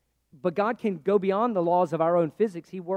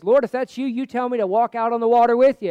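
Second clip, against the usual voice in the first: loud, higher-pitched call across water; 5 semitones higher, 10 dB louder than usual. Acted. Preacher as Peter calling to Jesus from the boat, calling out. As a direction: call out boldly over a distance.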